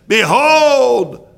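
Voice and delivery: high, loud, indignant proclamation; hammed-up furious shout; wrathful outburst. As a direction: cry it out like an offended commander. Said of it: "Naaman was wroth. He was mad".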